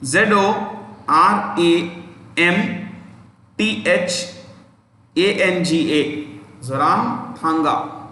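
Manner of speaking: letter by letter, slow and deliberate; slow spelling. Shown as a demonstration. Teacher spelling the chief minister's name slowly. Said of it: "I'll spell it".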